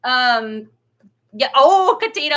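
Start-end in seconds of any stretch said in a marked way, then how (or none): 1.6-2.3 a bright, loud and higher hello to a viewer; excited greeting. Real, not acted. Vlogger spotting a familiar viewer in chat, excited. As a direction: call out her name with delight.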